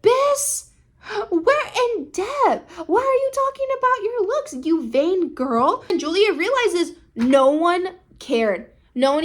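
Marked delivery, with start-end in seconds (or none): 0.0-5.8 high, indignant mock rebuke; hammed-up high scolding with stretched words; scolding mom. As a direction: imitate a mother snapping at her daughter. Mom scolding her daughter.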